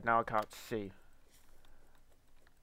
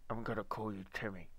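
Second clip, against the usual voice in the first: ordinary talk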